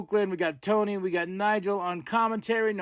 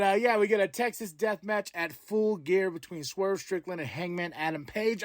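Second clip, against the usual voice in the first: ordinary talk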